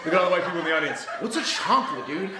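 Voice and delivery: put-on stand-up voice, laughing at his own joke; milk the crowd between chuckles; Carlos Mencia impression. Reviewer parodies a comic working the audience, hammed-up stand-up delivery. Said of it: "my best attempt at Mencia".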